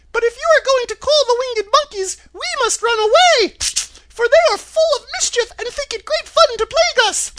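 very high, loud squeaky mouse; tiny squeaky royal mouse voice; Queen of the Mice. A narrator's reading. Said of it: "in her squeaky little voice".

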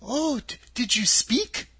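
put-on high-pitched girlish wonder. A narrator's reading. Dorothy hearing a scarecrow talk, in wonder. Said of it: "asked the girl in wonder".

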